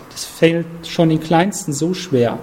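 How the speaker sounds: ordinary delivery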